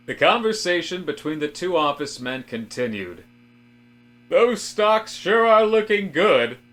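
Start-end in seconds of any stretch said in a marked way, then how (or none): 4.3-6.6 high, loud, slow boastful cheer; chipper George. Acted. George brags to Robert about stocks, high and hearty. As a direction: beam it out like a proud stock watcher. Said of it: "George said".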